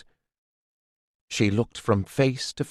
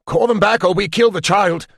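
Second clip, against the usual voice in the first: higher, louder panicked yell; crying out; 9 semitones higher, 6 dB louder than usual. A narrator's reading. Redbeard threatening the child while bees attack him, crying out. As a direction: blurt it out, swatting at bees. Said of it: "he cried out suddenly".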